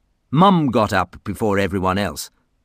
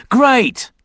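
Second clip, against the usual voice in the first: high loud happy cheer; excited; 7 dB louder than usual. Acted. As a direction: cheer together. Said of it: "said Lee and Ross".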